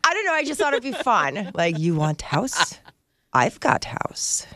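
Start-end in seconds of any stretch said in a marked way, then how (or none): 1.8-4.4 slow, low, broken English pitch; lightly put-on Eastern European hustler; Eastern European accent. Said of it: "Is this Eastern European?".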